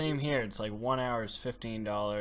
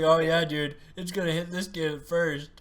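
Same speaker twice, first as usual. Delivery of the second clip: loud, high mock-confident voice; cocky kid impression; 8 semitones higher, 7 dB louder than usual. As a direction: play a kid sure he's safe, much louder and higher. Voicing the confident kid before impact.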